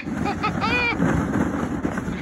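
high breathless laughing; thrilled laughter. Real, not acted. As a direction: laugh while speeding down. Laughing while sledding fast.

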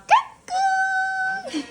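high drawn-out peekaboo. Real, not acted. Peekaboo to baby.

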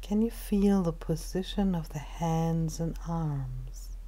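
calm, unhurried meditation guide with long pauses; measured body-scan narration, phrase by phrase; guided meditation. Acted.

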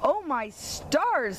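high, slow squealing exclamation; pig's squeal. Acted. Startled pig knocked down by the truck, high slow squeal. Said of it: "squealed Raleigh Pig".

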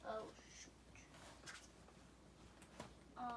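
whispered oops, barely voiced. Real, not acted. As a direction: breathe it out under your breath. Drops a piece, whispers.

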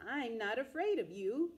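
put-on plucky old lady refusing to back down; bravely. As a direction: declare it without fear. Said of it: "said the little old lady bravely".

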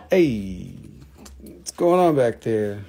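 hammed-up Boston accent, calling out. Shown as a demonstration. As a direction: call it out in mock Bostonian. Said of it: "That's too Boston".

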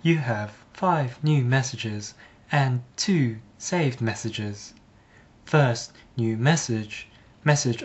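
slow, measured automated-message voice; plastic voicemail woman. Retold or imitated. Woman at the voicemail service reading out messages, plastic voice. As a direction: recite it like a synthetic phone menu. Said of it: "with her insufferable plastic voice".

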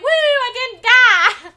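loud, high, drawn-out cheer; triumphant whoop. Real, not acted. Gamer who avoided it that time, triumphant whoop. Celebrates surviving.

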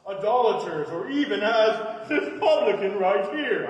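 loud, slow prayer in a put-on accent; a boastful Pharisee's prayer in a loud, slow put-on accent. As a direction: proclaim it loudly and slowly in a made-up accent. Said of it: "I don't know where I got that accent".

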